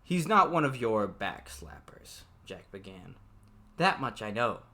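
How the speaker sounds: even novel reading voice; story narration. A narrator's reading. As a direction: read the book aloud, steady. Reads novel aloud.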